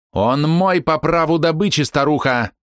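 high, slow, furious claim; fierce fury. Acted. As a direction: spit it with savage malice. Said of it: "звучал с такой лютой злобой".

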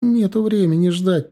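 impatient high-pitched squeak. A narrator's reading. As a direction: pipe up in a high voice. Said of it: "пискнула Марина с заднего сиденья".

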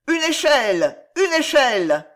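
high, loud cry; crowd shouting. A narrator's reading. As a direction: call out urgently like a crowd. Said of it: "et criaient". (crier comme une foule)

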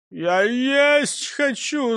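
slow, high-pitched whimper; drawn-out, plaintive whinge; whining. A narrator's reading. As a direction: whine like a hungry child. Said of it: "захныкал Крендель".

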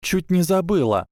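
put-on high, nervous girl's voice, trembling; Трейси Дэвис. A narrator's reading. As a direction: pitch it way up and tremble. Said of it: "сказала Трейси Дэвис дрожащим голосом". (высокий дрожащий голосок)

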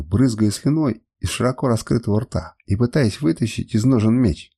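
plain reading voice

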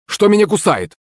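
высокий испуганный выкрик; вскрикни от укуса; испуганный вопрос. A narrator's reading. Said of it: "вскрикнул он". (испуганный выкрик бармена)

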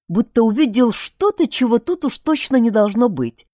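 plain reading voice

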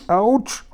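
high yelp of pain; pained howl. A narrator's reading. Guri with a tickled, aching ear, pained howl. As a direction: howl out as if hurt. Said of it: "heulte der Guri".

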